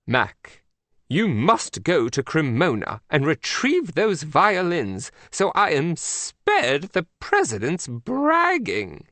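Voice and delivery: grandly dispatch the agent; Queen of England. Acted. The Queen orders Mac to retrieve the violins, grand.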